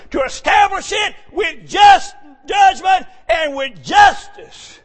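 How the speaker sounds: high, loud fiery scripture read; preaching at full force. Acted. Preacher reading scripture to his church, shouting. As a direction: thunder the verses out.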